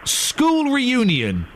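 drawn-out, patient explanation of a phrase; slow spelling-out. Real, not acted. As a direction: stretch both words right out for a confused listener.

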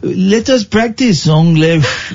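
stretched mock-French vowels; coaxing comic French accent. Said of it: "in my French accent".

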